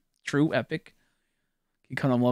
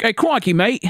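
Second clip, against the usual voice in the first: attempted Australian accent; 7 semitones higher than usual. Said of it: "I can't think of an Australian accent".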